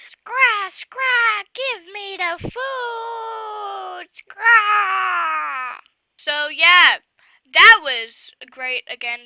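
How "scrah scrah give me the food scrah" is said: high screeching vulture squawks. Acted. Imagining vultures screeching for the food.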